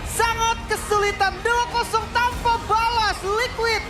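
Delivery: high, slow, ecstatic caster call; excited victory call. Acted. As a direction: soar over the winning moment. Caster calls the clean sweep.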